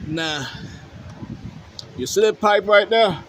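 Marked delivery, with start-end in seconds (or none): 2.0-3.2 louder, higher, eager to show; excited pointing. Real, not acted. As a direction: call attention to the pipe.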